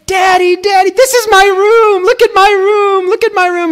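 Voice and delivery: put-on very high, loud little girl squeal; excited child shout. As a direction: squeal it like a thrilled toddler. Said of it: "she gets so excited".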